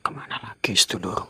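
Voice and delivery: same question dropped to a hushed whisper. Real, not acted. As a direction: whisper it close to the mic, tense. Ghost hunt, repeated question.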